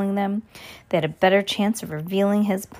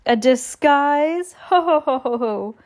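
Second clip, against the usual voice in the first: drawn-out, higher question into ho-ho laugh; amused villain; 6 semitones higher, slower than usual. A narrator's reading. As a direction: put on a slow, hammed-up belly laugh. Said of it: "that Klaus was joking".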